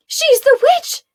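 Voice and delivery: high, loud accusing scream. Acted. Prisoners screaming at Arella, high and loud. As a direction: scream it out at the witch. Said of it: "they screamed".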